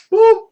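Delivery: high loud boop. Real, not acted. Hype sound effect.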